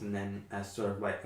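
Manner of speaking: normal talking voice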